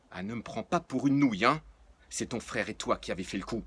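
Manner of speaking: loud, furious accusation; furious father figure scolding; angry outburst. Acted. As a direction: blow up at the kids. Nours explodes at children.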